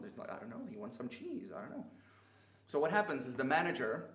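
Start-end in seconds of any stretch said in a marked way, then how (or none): 0.3-1.9 higher, softer gossiping murmur; hushed, high-pitched mock bystander; whispering bystander. People in the crowd gossip about the cheese customer, mock whispering. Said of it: "whispering to everybody else".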